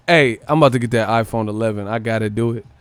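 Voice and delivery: ordinary talk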